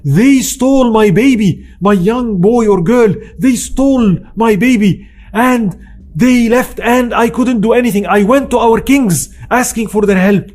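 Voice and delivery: a touch of a desperate mother's plea; retold crying. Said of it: "she starts crying and says".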